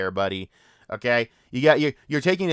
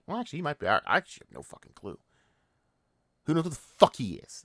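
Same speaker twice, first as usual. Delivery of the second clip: normal talking voice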